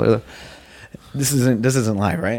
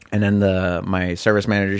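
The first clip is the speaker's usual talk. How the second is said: usual speaking voice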